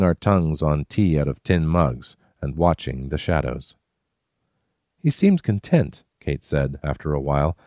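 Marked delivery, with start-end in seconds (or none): 5.0-6.0 put-on high, musing young woman; a wondering woman by the campfire; Kate's voice. A narrator's reading. Narrator voicing Kate, high and wondering.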